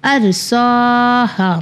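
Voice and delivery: ارساہا read slowly, each syllable drawn out; deliberate teacher's ارساہا. Shown as a demonstration. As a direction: sound out ارساہا carefully for pupils. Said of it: "spelling out the word".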